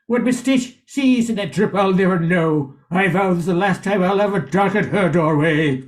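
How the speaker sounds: ordinary delivery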